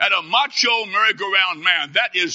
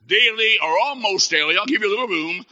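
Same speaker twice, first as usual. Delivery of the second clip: ordinary talk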